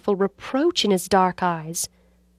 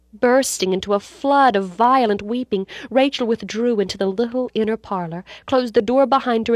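usual speaking voice

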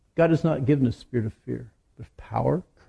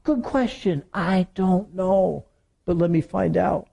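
a higher, slow, easygoing demo reply; friendly honest answer; 5 semitones higher, slower than usual. Shown as a demonstration. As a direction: show them how to say it kindly and slowly. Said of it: "Just say".

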